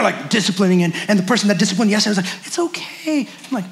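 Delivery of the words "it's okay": soft, higher-pitched reassurance; gentle, cooing mock comfort; acted soothing. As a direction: play the suddenly gentle parent, hushed. Strict parent now turned soothing.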